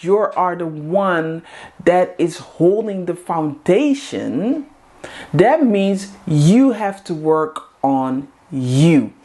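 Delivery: ordinary delivery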